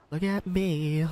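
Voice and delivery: high put-on cartoon voice; cartoon cat voice. Then Garfield voice.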